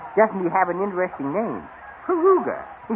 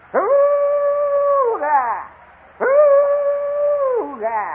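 very high, drawn-out jungle cry; jungle call; 7 dB louder, much slower than usual. Shown as a demonstration. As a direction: let it rip, high and long. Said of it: "I wonder if I could do it".

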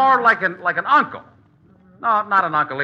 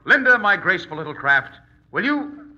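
ordinary talk, nothing marked

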